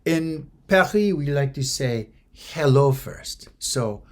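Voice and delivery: put-on lightly French-accented shop woman, slow and patient; French pharmacist. French pharmacist teaching Carol the greeting, slow French lilt. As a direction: school the tourists gently in a French lilt. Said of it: "the woman says".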